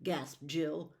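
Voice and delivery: plain reading voice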